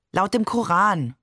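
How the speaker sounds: plain reading voice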